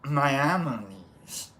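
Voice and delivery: Maimonides said slowly, syllable by syllable, unsure of the stress; careful try at a name. Real, not acted. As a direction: sound out Maimonides carefully, low and slow. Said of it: "I think that's how you say that".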